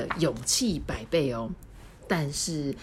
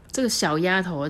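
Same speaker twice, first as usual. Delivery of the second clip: ordinary delivery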